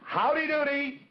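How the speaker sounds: high, bright puppet voice calling out; Howdy's greeting. Acted. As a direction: sing out the greeting high and bouncy. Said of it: "Well, let's try it".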